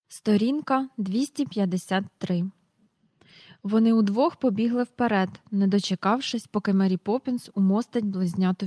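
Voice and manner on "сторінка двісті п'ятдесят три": ordinary delivery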